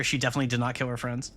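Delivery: ordinary delivery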